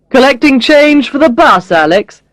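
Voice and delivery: lightly voiced mocking boss; teasing boss. A narrator's reading. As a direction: needle an employee with a dry joke. Boss teases her picking up coins.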